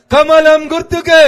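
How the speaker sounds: slow, very loud rally chant; loud slogan. Real, not acted. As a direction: belt out the slogan with everything. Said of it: "జర గట్టిగా చెప్పాలి".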